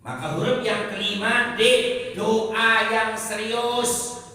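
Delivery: high, loud, forceful; fiery preaching. Real, not acted. Preacher urging the crowd to pray in earnest, loudly. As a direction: hammer the point home.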